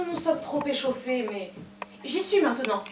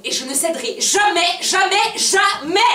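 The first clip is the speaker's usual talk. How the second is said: defiant shout; 6 semitones higher, 5 dB louder than usual. Acted. Quarrel, defiant refusal.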